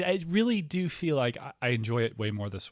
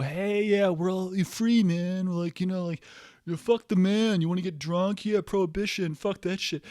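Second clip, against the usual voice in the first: 1 mock hippie; 6 semitones higher than usual. Mocking hippie speakeasy owner.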